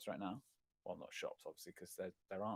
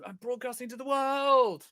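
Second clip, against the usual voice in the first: much higher and louder, hammed-up thrill; mock excited; 13 semitones higher, 11 dB louder than usual. Recalling first YouTube broadcast, hammed-up excitement. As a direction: play up your past self's nervous excitement. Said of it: "really energized".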